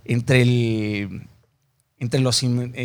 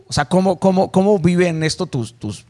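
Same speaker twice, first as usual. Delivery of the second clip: usual speaking voice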